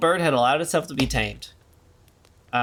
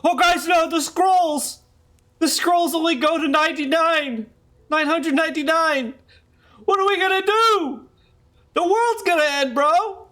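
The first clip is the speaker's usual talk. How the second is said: panicked medieval scholar; 13 semitones higher than usual. Pretend. Joking about Y one K, high hammed-up panic. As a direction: ham up a scribe whose scrolls run out. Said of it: "what about Y one K?".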